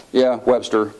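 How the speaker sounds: understated, uninterested echo; blowing him off. Retold or imitated. Sleepy congressman answering his chatty colleague, dismissive. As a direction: toss it back to humour him. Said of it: "I'm just blowing him off".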